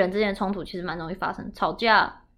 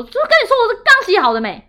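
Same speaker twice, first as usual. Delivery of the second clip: high, loud exasperated yell at mom; furious retold outburst; 12 semitones higher, 9 dB louder, faster than usual. Daughter yelling at her mother over the dishes, furious high shout. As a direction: blow up at her in frustration. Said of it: "我第三次我就暴怒".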